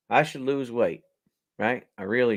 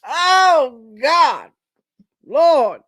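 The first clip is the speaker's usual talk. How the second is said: laughing exclamation; 7 semitones higher, 9 dB louder, much slower than usual. Real, not acted. Laughing at his own joke.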